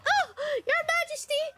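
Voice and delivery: very high, squealing coy apology; mock damsel. Impostor whose shoulder showed to prince, mock flustered. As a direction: ham up fake embarrassment, far above your voice. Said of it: "shoulder showed and she's like".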